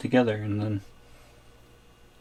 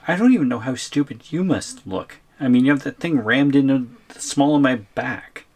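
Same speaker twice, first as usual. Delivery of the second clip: indignant protest; 3 semitones higher, 7 dB louder than usual. Acted. Captive complains about being pushed.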